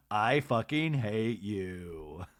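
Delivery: slow, low mock contempt; flat hate. Recapping Lisa snapping at Whitney, slow contempt. Lisa's hostile retort quoted.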